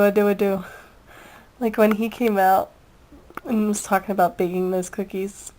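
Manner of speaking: ordinary talk, nothing marked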